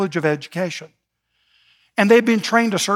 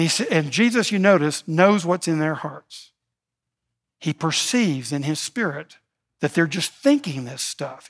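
ordinary delivery

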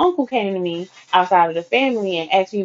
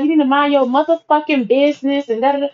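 high, dismissive put-down; mock snapping friend; 7 semitones higher than usual. Friend telling her to mind her business, mock. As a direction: pitch it up and brush her off.

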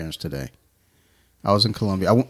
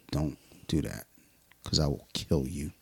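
quiet threat; 5 semitones lower, 10 dB quieter than usual. Retold or imitated. Warning the client about boxing.

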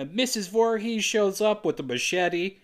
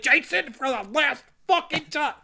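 exasperated mom; 9 semitones higher than usual. Retold or imitated. Exasperated mother voice.

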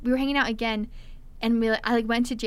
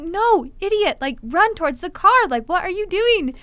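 higher, louder scolding shout; exasperated yell; 7 semitones higher, 5 dB louder than usual. Retold or imitated. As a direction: shout at him to run. Narrator yelling at date.

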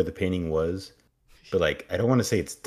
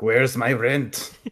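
loud, higher, drawn-out demand; demanding landlord; 6 semitones higher, 6 dB louder, much slower than usual. Acted. Landlord opens the door on his tenant, bellowing for rent.

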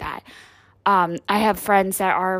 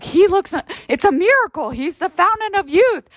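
much higher, louder awe; mock amazement; 9 semitones higher, 3 dB louder than usual. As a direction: marvel like it's a miracle. People marvelling at father.

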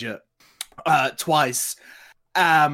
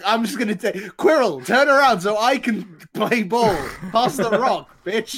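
hammed-up villain quote, raised pitch; mock movie-villain demand, pitched up; acted movie villain; 6 semitones higher, 4 dB louder than usual. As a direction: play the movie line higher and livelier. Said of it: "they're like".

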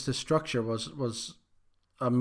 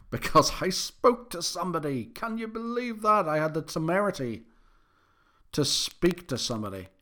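high-pitched mock outrage; mock incredulity; 5 semitones higher than usual. Owning up to listeners about speaking to somebody, mock incredulous. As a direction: feign scandal, pitch climbing right up.

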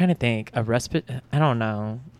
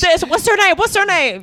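high-pitched, excited groping for a name; frantic name-searching; 12 semitones higher than usual. Acted. TV viewer hunting for the actress's name, frantic. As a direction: act out racking your brain, way up high.

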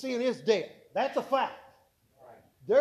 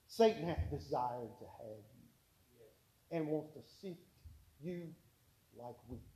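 grave hushed warning; 6 semitones lower, 7 dB quieter than usual. Acted. Jesus warning Peter, low and hushed.

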